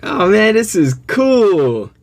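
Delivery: high, slow, drawn-out delight; real excitement. Real, not acted. Unboxing a gift from an admired legend, excited. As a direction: stretch the words out high, thrilled. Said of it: "in my excitement in my voice".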